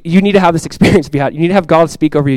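usual speaking voice

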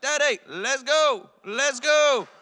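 put-on high, drawn-out child's demand; imitated toddler. Toddler son answering dad's question, imitated insistent call. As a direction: insist like a two-year-old who will not wait. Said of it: "He's like".

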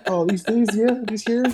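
high, preening show-off voice; smug show-off. Pretend. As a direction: show them off proudly. Showing off socks, mock quote.

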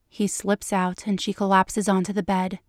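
ordinary delivery, nothing marked

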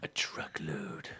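low, slow drawn-out repeat; mock deep echo. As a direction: drop the voice right down and stretch it.

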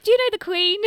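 American fan asking the British girl, in a bad American accent. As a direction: squeal it like an excited American fan. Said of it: "my really bad American accent".

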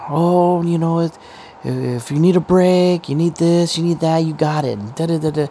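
hammed-up fawning over a witness; mock coddling senator. Mocking senators fawning over the witness, sugary high.

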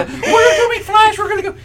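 put-on high, laughing kid voice; excited little son. Dad imitates his excited son before meeting Flash, laughing high kid voice. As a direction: bounce it like a giddy six-year-old.

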